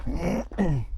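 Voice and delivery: talking while winded; breathless, catching breath. Real, not acted. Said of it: "feel a bit out of breath climbing up".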